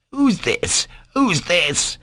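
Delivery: high, indignant repeated demand; enraged. A narrator's reading. Doctor demands to know who the boy is, enraged. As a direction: demand it twice in rising outrage. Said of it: "flew into a terrible rage".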